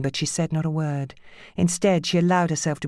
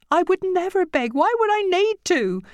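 much higher, distressed protest; tearful woman; 3 dB louder than usual. A narrator's reading. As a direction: insist through tears.

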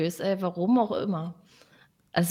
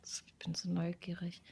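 whispering; 4 semitones lower, 18 dB quieter than usual. Real, not acted. Suspense before winner draw.

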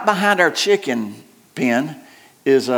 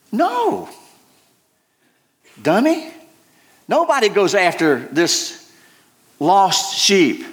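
scoffing inner retort; 3 semitones higher, slower than usual. Retold or imitated. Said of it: "And I, and I'm thinking".

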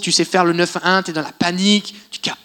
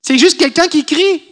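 loud; 9 semitones higher, 6 dB louder than usual. Retold or imitated. Preacher imitates shouting.

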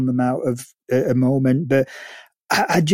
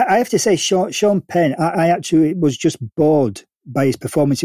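ordinary talk

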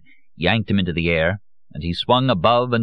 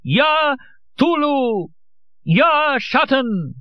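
much higher, very slow chant; loud invocation; 3 dB louder, much slower than usual. A narrator's reading. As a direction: call out the names loudly. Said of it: "he said in a loud voice".

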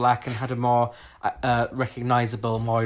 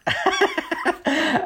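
a high-pitched burst of real laughter; high squealing laugh; 4 dB louder than usual. Real, not acted. Squeals after the corgi joke.

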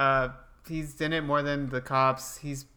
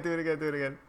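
ordinary talk, nothing marked

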